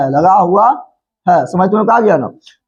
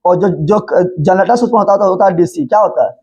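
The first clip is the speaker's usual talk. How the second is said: ordinary talk, nothing marked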